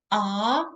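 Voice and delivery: rising second tone ah. Shown as a demonstration. Teacher demonstrating the second tone, rising. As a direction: let ah rise up. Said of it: "the second".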